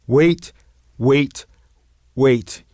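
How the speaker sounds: rising urgent calls. Acted. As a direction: cut in urgently. Urgent interjection.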